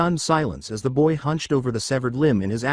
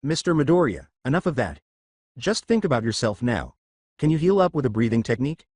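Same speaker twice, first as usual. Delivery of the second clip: plain reading voice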